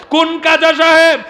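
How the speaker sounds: high and loud, hurled at the crowd; shouted preacher question. Acted. Preacher challenges the audience with a loud, high question. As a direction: throw the question out to the whole crowd.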